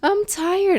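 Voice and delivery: imitating a sulky little boy; whiny kid. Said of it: "He's like".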